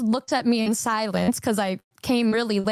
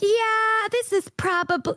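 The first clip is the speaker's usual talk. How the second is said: retold realization; 11 semitones higher than usual. Storyteller to herself about her sexuality, retold dawning realization. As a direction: start high on a long dawning yeah.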